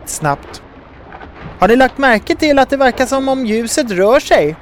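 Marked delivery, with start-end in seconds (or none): 1.6-4.6 high-pitched call to others below. A narrator's reading. Sol in flight to the boat crew, calling down. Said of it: "ropade Sol ner till de andra".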